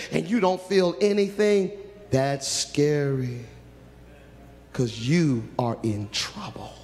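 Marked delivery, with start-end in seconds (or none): 2.1-3.4 stretched out slow and heavy; slow grave warning. Acted. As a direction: drag each word out, ominous. Repeats line slowly.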